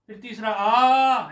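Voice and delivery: "आ" held slowly as the third length; longer aa. Shown as a demonstration. Teacher showing the third vowel length with आ. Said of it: "ये भी तो कह सकते थे".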